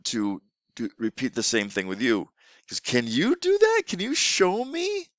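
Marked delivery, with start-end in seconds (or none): 2.7-5.1 much higher, slow invitation; high coaxing teacher. Shown as a demonstration. Teacher asking a student to copy the gesture, high and coaxing. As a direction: invite the child to copy you. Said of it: "use full T P R".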